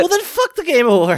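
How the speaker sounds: loud, high indignant yell; angry outburst. Real, not acted. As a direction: blurt it out, outraged. Outraged outburst at awards.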